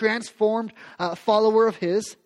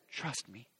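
gentle hush; 8 semitones lower, 18 dB quieter than usual. Acted. Jesus voiced, quiet and low.

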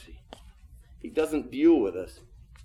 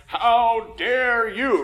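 slow, louder mock indignation, pitched up; indignant; 7 semitones higher, 6 dB louder, much slower than usual. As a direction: drag out an offended parent's how dare you. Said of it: "I'm like".

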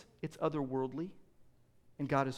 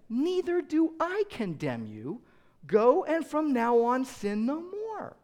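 gentle Jesus; 8 semitones higher, 4 dB louder, slower than usual. Acted. Jesus gently forgiving the woman caught in adultery, slow and tender. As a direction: lay it on her gently and slowly.

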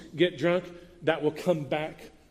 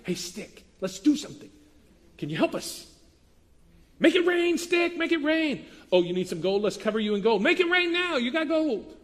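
idolater pleading with a stick; 10 semitones higher than usual. Pretend. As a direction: beg a wooden stick to make it rain, over the top. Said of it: "That sounds terrible".

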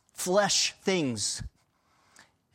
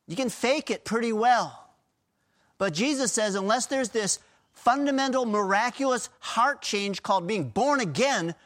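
preaching at full force; 7 semitones higher than usual. Acted. Pastor urging the congregation about the new birth, fervent preaching. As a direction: drive it home to the congregation.

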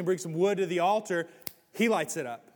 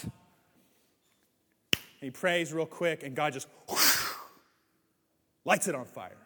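normal talking voice

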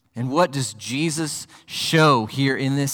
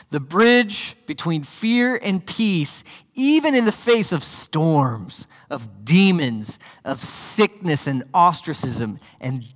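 slow, higher, weighty sermon delivery; emphatic preaching; 3 semitones higher, slower than usual. Acted. Preacher urging listeners toward faith, slow and emphatic. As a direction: press each phrase home slowly.

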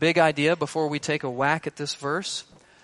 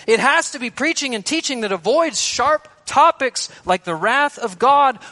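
higher, louder, insistent demands; insistent voice of the world; 7 semitones higher, 5 dB louder than usual. Acted. The world lecturing the preacher on his sermons, raised and insistent. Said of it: "The world in our day says".

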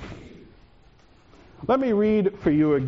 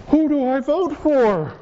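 anxious; 8 semitones higher than usual. Acted. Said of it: "It makes me very anxious".

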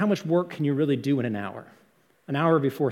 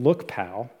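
edgy "look, pal"; 5 semitones lower than usual. Shown as a demonstration. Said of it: "that sort of edge to the word".

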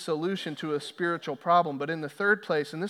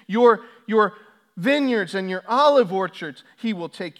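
loud, higher, relentless litany; thundering warning; 6 semitones higher, 9 dB louder than usual. Acted. Samuel warning Israel about the king, thundering preaching. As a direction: hammer each "take" like a prophet.

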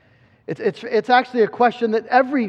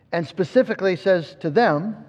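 ordinary talk

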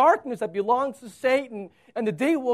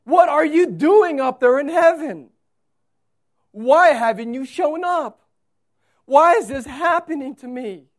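anguished crying out; 6 semitones higher, 10 dB louder than usual. Acted. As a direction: shout it up at God in anguish. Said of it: "can't you hear them crying out".

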